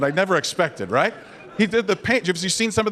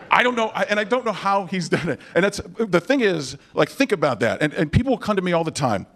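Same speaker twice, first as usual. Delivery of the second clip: ordinary talk, nothing marked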